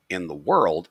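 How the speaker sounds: usual speaking voice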